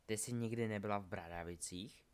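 mild, soft puzzled question; surprised. A narrator's reading. Scorpius questions Delphi about Hogwarts, mildly surprised. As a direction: ask quietly, with a touch of wonder. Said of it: "podivil se Scorpius".

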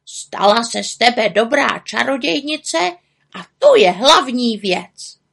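raven's called-out farewell in character voice; calling after her. A narrator's reading. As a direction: keep calling after the departing friend. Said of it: "volal za ní na rozloučenou".

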